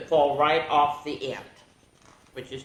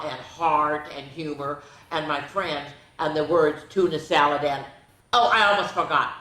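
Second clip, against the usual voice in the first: Wordy Birdie voice; 2 semitones higher than usual. Acted. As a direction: play the chatty little bird bragging. Reads bird's back-cover blurb.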